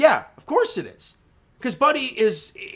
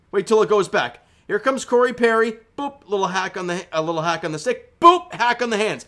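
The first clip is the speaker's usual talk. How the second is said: ordinary delivery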